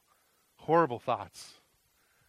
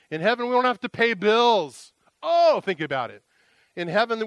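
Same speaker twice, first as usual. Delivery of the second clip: high, loud, rising preacher's call; preaching at full force; 6 semitones higher, 6 dB louder than usual. Acted. Pastor preaching to the congregation about heaven, at full force. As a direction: preach heaven's promises with mounting fervour.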